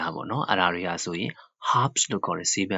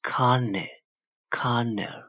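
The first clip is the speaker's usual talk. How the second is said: higher-pitched COLonel, like "KAR-nel"; stress on first syllable; 4 semitones higher, much slower than usual. Shown as a demonstration. Teacher demonstrates the strike, stressed first syllable of colonel. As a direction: put the weight at the front of colonel. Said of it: "Strike ကရှေ့မှာထားတယ်".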